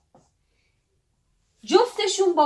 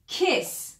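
ordinary talk

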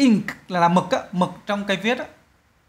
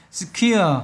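"Secure" with a long, falling second syllable; second-syllable stress. Shown as a demonstration. Teacher repeats for students, "Secure" stressed on the second syllable. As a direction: put the weight on CURE in "Secure". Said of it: "nhớ năm hai nha".

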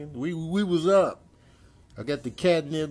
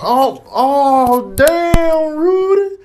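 high, loud shouting at a friend; yelling; 15 dB louder than usual. Real, not acted. Yelling at Rudy blocking the camera, loud. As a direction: shout him off the shot.

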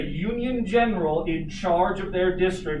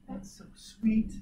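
imitating a charmed girlfriend, soft and drawn out; slow, higher, softly cooed; date's gushing reply; 5 semitones higher, 5 dB quieter, slower than usual. Teacher imitates his date getting flowers, softly gushing. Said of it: "She just goes".

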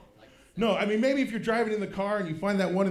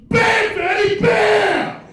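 huge high roaring yell; booming monster shout; gorilla shout; 15 dB louder, much slower than usual. Pretend. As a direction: bellow it like a gorilla attacking. Edible high hits like gorilla.

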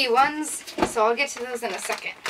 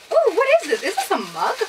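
excited curiosity; 7 semitones higher, 4 dB louder, faster than usual. Real, not acted. Curious at new item.

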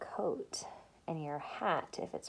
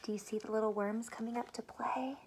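gentle storytime whisper; whispered read-aloud; 3 semitones higher, 4 dB louder, slower than usual. A narrator's reading. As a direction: hush it like a secret to kids. Whispered reading to children.

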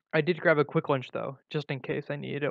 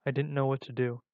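usual speaking voice

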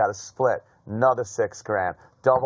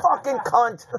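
retold yelled curse; 11 semitones higher than usual. Gambler yelling at the deal, retold mock yell. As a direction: re-enact cursing at the cards, pitched up. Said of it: "I got yelled at for yelling".

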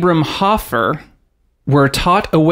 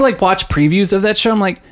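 ordinary talk

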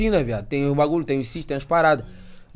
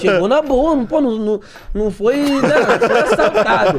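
raised voice, higher pitch; loud teasing protest; 6 semitones higher, 7 dB louder than usual. Real, not acted. Friends disputing whether it was a real robbery, loud teasing. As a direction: protest loudly over the laughter.

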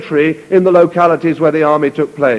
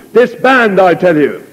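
shouted order; 3 semitones higher than usual. Acted. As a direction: order them to break ranks. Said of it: "he raised his voice".

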